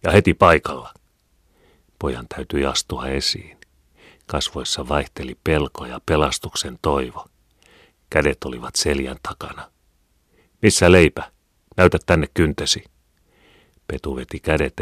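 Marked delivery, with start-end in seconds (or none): 0.0-0.9 loud, firm command; angry roar. A narrator's reading. Mother ordering her son out at once, barking angrily. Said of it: "hän ärjäisi". 10.6-12.8 stern demand. A narrator's reading. Mother interrogates son, angry.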